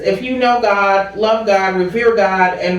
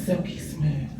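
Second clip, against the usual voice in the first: smooth radio voice; 5 semitones lower, 12 dB quieter than usual. Pretend. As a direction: breathe the name like a late-night radio host. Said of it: "Why you gotta say it like that?".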